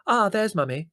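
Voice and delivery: high, loud glad call; excited cry. A narrator's reading. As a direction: cry out as she spots her mother. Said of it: "cried Prue".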